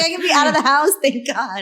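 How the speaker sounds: high, loud relieved cheer; celebrating freedom after lockdown; playfully overjoyed; mock joy. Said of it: "You're gonna be like".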